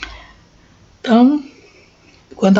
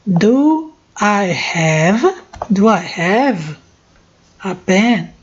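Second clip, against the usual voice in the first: slow model sentence; slower than usual. Shown as a demonstration. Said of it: "Tô dando pausa pra vocês pronunciarem".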